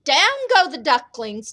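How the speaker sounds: usual speaking voice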